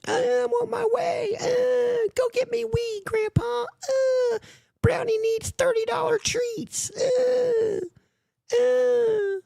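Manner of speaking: put-on very high, whiny crying shout of a spoiled child; mock toddler tantrum. Mocking a rival as a toddler demanding weed, high crying whine. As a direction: wail like a toddler kicking on the floor. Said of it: "You're like a, a toddler on the floor, kicking and screaming".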